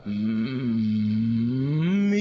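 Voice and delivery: low creaky buzz; vocal fry. Shown as a demonstration. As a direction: buzz low in the throat, like a mosquito. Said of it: "うなり声のような".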